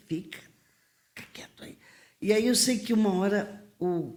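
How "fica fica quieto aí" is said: whispered shush, soft and tight; telling her youth to keep quiet, whispered; hushed warning. Retold or imitated. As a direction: whisper it so nobody hears.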